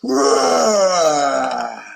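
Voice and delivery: a mild chest-thumping gorilla roar; proud roar. A narrator's reading. Gordon roaring proudly to the animals. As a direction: show off with a roar. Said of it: "he roared".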